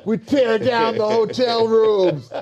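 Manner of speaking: mock rock-star boast. Host mocks wild rock band life, hammed-up shout. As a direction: play the wild rocker bragging about trashed rooms.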